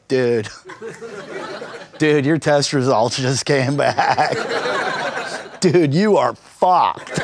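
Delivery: slow, laid-back surfer dude delivering a diagnosis; mock-casual doctor on the phone, drawn out; surfer neurosurgeon. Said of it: "can't call a guy on the phone and be like".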